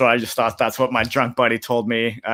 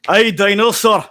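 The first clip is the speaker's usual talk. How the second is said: put-on Scottish accent; 8 semitones higher, 5 dB louder than usual. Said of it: "Accent wasn't bad".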